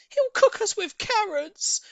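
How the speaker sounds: put-on high loud slow child's cry; a scared little boy crying out; high, slowed, panicky kid voice. A narrator's reading. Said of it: "cried the third".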